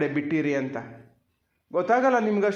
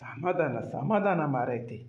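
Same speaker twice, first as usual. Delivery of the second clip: soothing calm-down; 6 semitones lower, 5 dB quieter, slower than usual. Acted. Man calms crying child.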